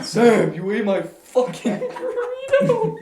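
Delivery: high, loud and slow, cracking up mid-line; mock accusation. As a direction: accuse him while laughing. Mock accusing friend over burrito.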